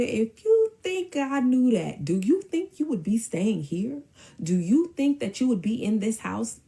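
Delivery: high, emphatic mom's interrogation; indignant, hammering each question; stern challenge. Retold or imitated. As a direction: imitate a mother laying down the law. Mom's retold confrontation of him.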